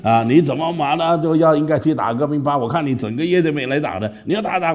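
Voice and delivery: put-on pastor coaxes the boy to play again; coaxing pastor. Pastor coaxing him back. (牧师温和地劝说)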